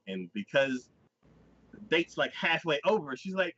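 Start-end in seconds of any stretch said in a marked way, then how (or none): none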